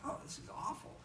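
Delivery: breathy, soft croak; Jay on Sunday, voice almost gone, breathy; losing his voice. Retold or imitated. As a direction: rasp it out, barely voiced. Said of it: "clears throat".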